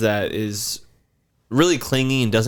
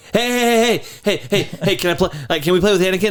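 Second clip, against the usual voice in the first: put-on higher, eager kid begging to play; pestering kid voice; 8 semitones higher than usual. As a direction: badger them like a clingy child. Imitating pestering neighbor kid.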